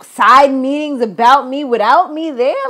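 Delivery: imitating an outraged woman who was left out; livid. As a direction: play Jackie's outrage, half acted. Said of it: "Jackie was a little livid about that".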